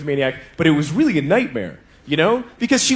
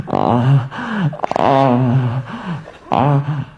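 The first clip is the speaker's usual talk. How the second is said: sex moans; 6 semitones lower, much slower than usual. Retold or imitated. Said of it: "you're like".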